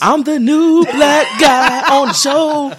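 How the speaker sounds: slow, high, loud laughing shout; mock protest. Guest jokingly protesting being cut off, slow laughing shout. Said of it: "Uh, I didn't say that".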